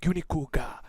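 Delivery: pointed Japanese one-liner before attacking; dramatic Japanese. Acted. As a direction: deliver a Japanese action-hero line, phrase by phrase. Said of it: "and I go".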